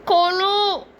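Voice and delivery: wordless hums, then a smothered plea; muffled mumbling from behind a gag; muffled gagged plea. Acted. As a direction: plead through a bound mouth. Gagged captive begs to be untied.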